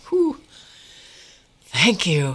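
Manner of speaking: mock relief, sigh then thank you; relieved whew. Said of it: "I was like".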